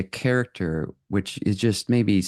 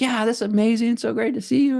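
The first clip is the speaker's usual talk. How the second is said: much higher, bubbly joyful gush; super happy; faster than usual. Retold or imitated. Entering a room while super happy, gushing high and bright. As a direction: gush like everything is great. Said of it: "super happy and joyful and just beboppity".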